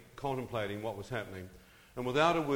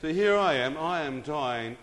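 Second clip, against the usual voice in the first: loud emphatic; 4 semitones higher, 8 dB louder than usual. Real, not acted. Storyteller's emphatic return.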